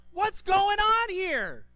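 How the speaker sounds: high, loud, slow scolding shout; furious parent walking into a wrecked house; angry parent yell. Retold or imitated. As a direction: bellow at the kids over the mess. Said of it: "we talk to our children".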